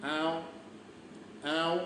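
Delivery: short, gentle imitated tigress call; quiet mimicked big-cat moan; soft tigress call. Shown as a demonstration. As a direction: call softly like a tigress to her cubs. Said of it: "एकदम soft आवाज काढू लागली".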